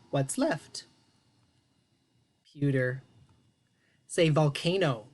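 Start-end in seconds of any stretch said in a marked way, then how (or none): none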